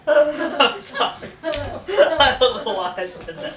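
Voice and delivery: high, laughing apology; giggling. Real, not acted. Girl cracking up at her own silly line, giggling. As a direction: laugh through the words, can't stop.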